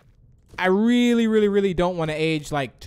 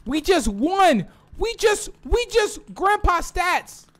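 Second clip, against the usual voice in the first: high, stretched-out victory cry; high, halting winner's cheer; real excited celebration; 13 semitones higher, 4 dB louder, slower than usual. Real, not acted. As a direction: burst out in stunned triumph. Celebrating a game win.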